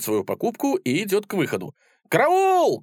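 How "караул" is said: very high, loud alarm cry; shout for help. A narrator's reading. Sellers raise alarm over the purchase, loud shout. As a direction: yell for help, pitch leaping up. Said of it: "кричат продавцы".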